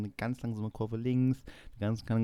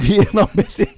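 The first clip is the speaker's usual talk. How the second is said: disbelieving; 10 semitones higher, 13 dB louder than usual. Retold or imitated. Retold disbelief at long slope.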